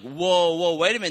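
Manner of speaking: a hammed-up startled whoa; startled backpedal. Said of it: "We're like".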